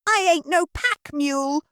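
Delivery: put-on high, common-girl maid voice; lazy servant talking back; cheeky maid. Maid answers from upstairs.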